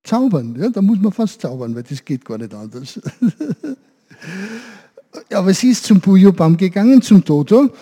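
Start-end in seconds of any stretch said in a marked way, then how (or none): 3.0-3.7 laugh. Real, not acted. Laugh after answer.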